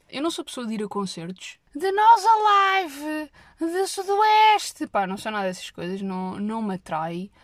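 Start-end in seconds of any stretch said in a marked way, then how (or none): 1.7-4.9 mock festival cheer. Mocking rowdy festival names.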